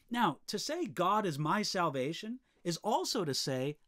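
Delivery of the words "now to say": ordinary talk, nothing marked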